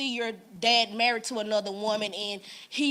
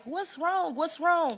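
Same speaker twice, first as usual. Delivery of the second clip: higher, anxious dad voice; worried dad; 7 semitones higher, much slower than usual. Retold or imitated. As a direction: lift it up like a startled father. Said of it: "He was like".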